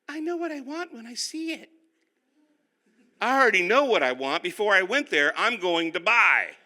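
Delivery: high put-on voice of his wife, certain; husband mimicking his wife to the congregation, in a high voice; imitating wife. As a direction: mimic the wife, sure of what she wants. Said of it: "I don't talk like that".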